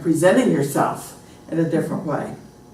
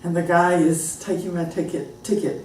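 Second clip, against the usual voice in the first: ordinary talk, nothing marked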